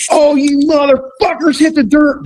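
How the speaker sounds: high, wild whooping threat; gleeful yell. Retold or imitated. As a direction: yell like a lunatic. Dave yelling with shotgun.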